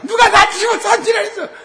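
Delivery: high, loud mock wailing; whiny crying son. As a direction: ham up a son's fussy crying complaint. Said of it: "걔 엄살 많거든요".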